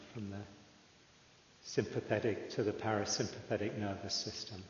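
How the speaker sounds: calm, unhurried meditation guide with long pauses; measured, settled voice leaving room to breathe; guided meditation. Real, not acted. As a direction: ease listeners into stillness, phrase by phrase.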